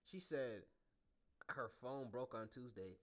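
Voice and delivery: ordinary delivery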